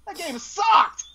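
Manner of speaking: mock outraged yell; retold shout. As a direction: bellow it like a fed-up actor.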